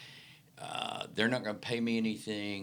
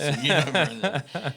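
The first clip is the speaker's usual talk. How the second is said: slow, higher and louder, laughter in the voice; laughing; 8 semitones higher, 11 dB louder, much slower than usual. Real, not acted. Still laughing with the host over the joke. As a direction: talk while still cracking up.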